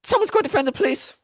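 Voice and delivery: high, loud frantic hushing; urgent retold plea. Brother pleading with screaming friend, frantic and high. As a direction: beg him to stop screaming.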